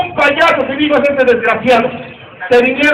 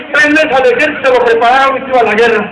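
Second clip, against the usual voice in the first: ordinary talk, nothing marked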